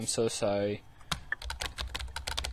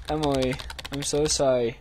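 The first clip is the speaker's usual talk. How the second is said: normal talking voice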